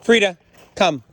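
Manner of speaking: dog command. Real, not acted. Trainer calls her dog Frida over, firm command. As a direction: call the dog over, two separate crisp words.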